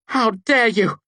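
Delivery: high, loud furious shout. A narrator's reading. Devi shouting at the narrator who bound her. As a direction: scream it at him. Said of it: "she shouted".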